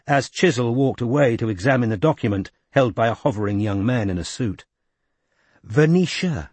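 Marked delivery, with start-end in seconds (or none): 5.6-6.5 higher, gracious Welsh woman's voice; poised Welsh lady. A narrator's reading. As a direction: speak with calm authority, Welsh lilt. Della voiced in story.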